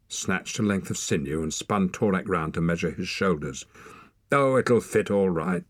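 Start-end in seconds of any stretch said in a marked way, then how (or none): none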